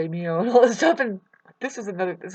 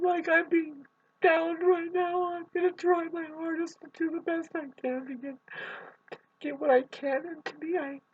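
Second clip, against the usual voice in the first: close to tears; 8 semitones higher than usual. Real, not acted. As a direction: struggle to get the words out through tears. Tearful after father's death anniversary.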